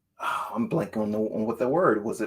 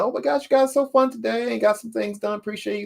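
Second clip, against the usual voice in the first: excited thanks; 10 semitones higher, faster than usual. Real, not acted. Thanking host, high bright.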